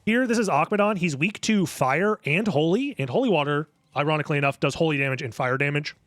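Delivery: usual speaking voice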